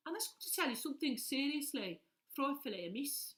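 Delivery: comic Midlands voice, game-show contestant style; Midlands accent. Acted. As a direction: recite it in a singsong Birmingham accent. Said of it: "if you're from the Midlands".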